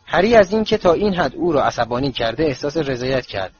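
plain reading voice

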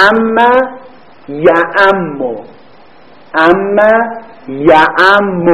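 یعم drawn out very slowly; slow repetition. Shown as a demonstration. Teacher repeats the form slowly for the class.

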